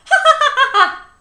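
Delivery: high, loud joyous laughter; big laugh. A narrator's reading. Relieved principal laughing before the class, high loud laughter. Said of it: "began to laugh".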